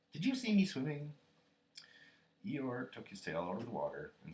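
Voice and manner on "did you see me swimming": high, loud piping question; thrilled little child voice; excited Roo. A narrator's reading. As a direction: squeak eagerly for praise. Roo still boasting.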